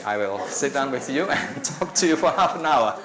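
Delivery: chuckling through the offer; a laugh breaking into the invitation. Real, not acted. Joking invitation to coffee.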